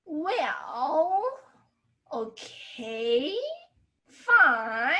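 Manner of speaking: reluctant words, each dipping and rising; unwilling, compromising. Shown as a demonstration. Teacher relates third tone to compromise, reluctant giving in. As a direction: give in grudgingly, word by word. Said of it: "you're not willing".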